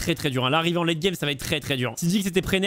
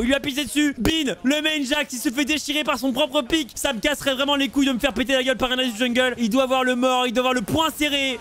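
high, excited rant after a big play; hyped outrage; 5 semitones higher than usual. Real, not acted. Caster reacting to a humiliating solo kill, hyped. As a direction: rant in amazed hype, pitched up.

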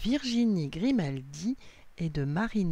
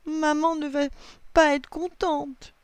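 whining; 10 semitones higher, 3 dB louder than usual. A narrator's reading. Lily dreads mum's reaction, whining. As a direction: moan it in worry, pitched up. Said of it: "gémit Lily".

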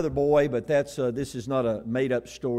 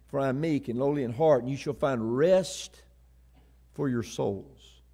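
gentle scripture quote; 2 semitones lower than usual. Acted. Preacher quoting Jesus's promise of rest, gently. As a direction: drop down and let each phrase rest.

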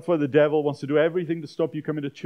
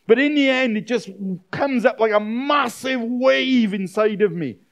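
raised, loud, agitated outpouring; agitated inner turmoil; 5 semitones higher, 5 dB louder than usual. Acted. A preacher voicing a troubled soul's chaos, agitated. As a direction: pour out the chaos, higher and louder.

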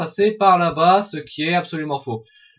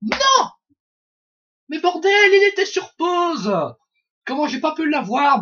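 furious shout; 9 semitones higher than usual. Real, not acted. Gamer raging at a missed kill, high furious yell. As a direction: rage at the screen.

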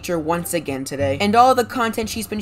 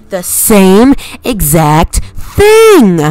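exasperated shout; 15 dB louder, much slower than usual. Acted. Insulting the vegan teacher.

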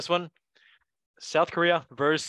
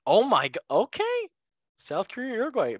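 surprised; 8 semitones higher than usual. Real, not acted. Surprised by unexpected pick.

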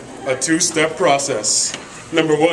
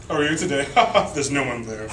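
ordinary talk, nothing marked

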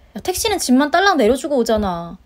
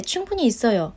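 normal talking voice

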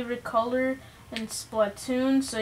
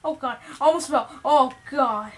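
startled yelp; 8 dB louder than usual. Real, not acted. Nearly falls off the stage.

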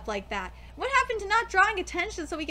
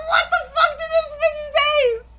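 high, loud disbelieving shout; stunned outburst; 11 semitones higher, 9 dB louder than usual. Retold or imitated. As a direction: lose it at the line. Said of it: "I was like".

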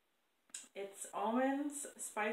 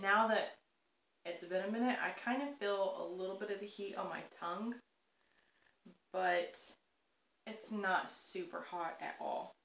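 fingers touching lips; speaking behind hand; hand near mouth. Real, not acted. Seen 0: Hand raised near mouth and chin during all labelled words.

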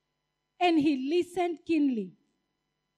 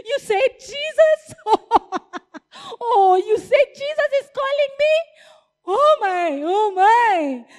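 much higher, loud and laughing; overjoyed; 6 semitones higher, 5 dB louder than usual. Acted. Blind man hearing Jesus calls him, overjoyed. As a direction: squeal it in disbelieving joy. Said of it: "Bartimaeus got so excited".

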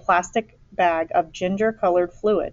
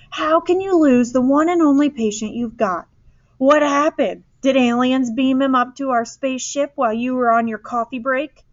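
high, raised-voice incredulous rant; angry; 7 semitones higher, 4 dB louder than usual. A narrator's reading. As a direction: fire outraged questions with pitch held high. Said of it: "Mister Eberhardt said angrily".

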